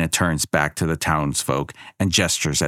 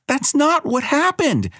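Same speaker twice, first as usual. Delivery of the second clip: put-on much higher protesting little girl; a child insisting it's untrue. A narrator's reading. Girl protests to the goddess, high and insistent. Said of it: "Abigail protests".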